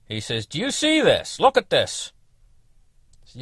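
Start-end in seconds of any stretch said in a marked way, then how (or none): 0.5-2.1 indignant neighbour. Retold or imitated. Neighbour pointing at the stump.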